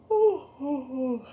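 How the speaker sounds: high startled squeal; startled ooh. Real, not acted. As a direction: yelp as the cup nearly spills. Coffee too full, nearly spilling.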